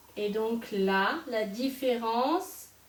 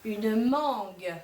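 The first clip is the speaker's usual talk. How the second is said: teacher dictating words one by one; word dictation. Shown as a demonstration. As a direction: dictate carefully to the class. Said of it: "vous allez m'écrire".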